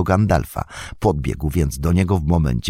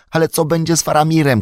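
much higher, fast frantic cry; 12 semitones higher, 4 dB louder, faster than usual. Acted. Pippin crying out to Gandalf about Faramir, fast and high. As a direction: blurt it out in alarm. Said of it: "krzyknął Pippin".

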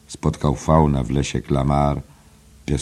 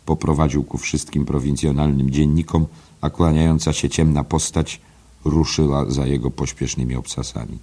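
normal talking voice

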